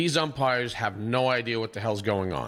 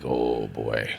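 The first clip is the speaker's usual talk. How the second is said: much lower, disappointed sigh; deflated. Retold or imitated. Host after the fight fizzled, deflated. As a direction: let the air out of it.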